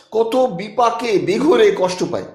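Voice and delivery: ordinary delivery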